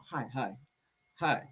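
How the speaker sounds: imitating Charles barely answering; standoffish hi. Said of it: "He's so standoffish".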